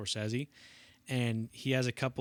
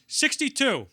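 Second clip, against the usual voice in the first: high loud calling out; mock Pacino, much higher and louder; 12 semitones higher, 11 dB louder, slower than usual. Pacino calls take number.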